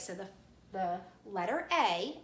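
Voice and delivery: normal talking voice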